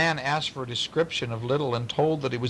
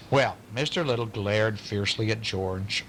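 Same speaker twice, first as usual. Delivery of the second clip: ordinary delivery, nothing marked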